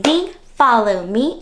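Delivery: usual speaking voice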